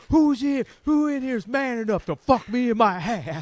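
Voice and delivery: loud, high mock drunk shout; drunk yell. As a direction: bellow the dare at the crowd. Drunk comic keeps repeating his challenge.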